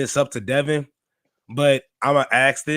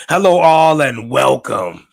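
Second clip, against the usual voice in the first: big show opening; 9 dB louder, slower than usual. Real, not acted. Host opening the podcast on a sore voice, loud announcer call. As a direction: belt the greeting despite a sore voice. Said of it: "this is gonna hurt".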